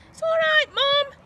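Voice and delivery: put-on very high, loud child's voice reassuring; boy's reassurance. A narrator's reading. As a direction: reassure mum as the eager boy. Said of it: "he reassured her".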